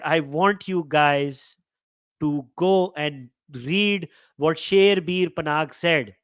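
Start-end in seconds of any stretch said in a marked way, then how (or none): none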